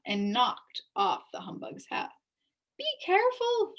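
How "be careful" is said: high, loud cry of alarm; shouted warning. A narrator's reading. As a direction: warn him in a hurry. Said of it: "shouted Milo".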